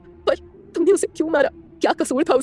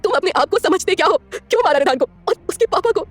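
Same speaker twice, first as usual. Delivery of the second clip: crying anger; 4 semitones higher, slower than usual. A narrator's reading. Heroine cries and rages at him in the car, high tearful fury. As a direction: shout through tears, slowing down. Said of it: "रोते हुए बोलती है".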